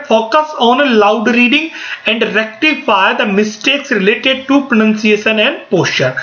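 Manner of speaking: slow, careful slide reading. Shown as a demonstration. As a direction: spell it out slowly for notes. Reads slide text aloud. (धीमी गति से slide पढ़ना)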